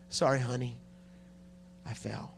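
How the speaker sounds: low, soft, halting apology; hushed confession. Acted. Pastor confessing to his wife in the bedroom, hushed and low. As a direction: drop away on the last words.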